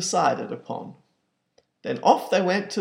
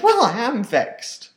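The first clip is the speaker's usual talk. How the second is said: vexed mother; 9 semitones higher, 6 dB louder, slower than usual. Acted. Mother reacts to Albert eaten.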